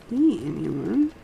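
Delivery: ordinary delivery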